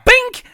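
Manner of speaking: loud high ping of a pellet hitting metal; mock pellet ping sound. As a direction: shout the ping like a pellet striking the sign. Imitating pellet hitting sign.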